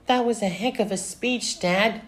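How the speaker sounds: high-pitched admiring daughter; Velma. A narrator's reading. Daughter praising her dad's speech, high voice. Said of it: "said Velma".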